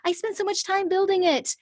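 high, protesting whine of a sunk cost; defensive inner voice. Retold or imitated. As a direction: whine like you can't let it go. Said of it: "sometimes it feels like".

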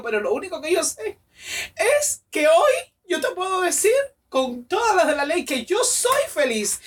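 high, impassioned, as if crying; retold tearful declaration. She confesses her new happiness to her boyfriend, tearfully impassioned. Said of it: "Y lloraba y lloraba y le decía".

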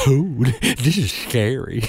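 a hint of a tiny boy with a lateral lisp, slow; lisping little Kentucky boy. Retelling a Kentucky boy backstage, lightly lisped child voice. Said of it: "tiny little Kentucky boy with a perfect lateral lisp".

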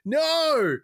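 a sudden high yelp of dismay; horrified no. Retold or imitated. Watching the match, crying out in high dismay. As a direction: cry out as the wrestler falls. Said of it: "I'm like".

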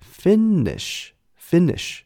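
Finnish said slowly and clearly, stress on the first syllable; slow demo of Finnish. Shown as a demonstration. Teacher showing students how to say Finnish, slow clear demo. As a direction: spell out Finnish slowly for learners. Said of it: "芬兰人叫做Finnish".

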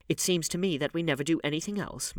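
usual speaking voice

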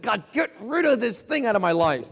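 high, insistent cry to God; urgent, passionate plea. Retold or imitated. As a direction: act out a desperate prayer. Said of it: "urgent, passionate, over and over".

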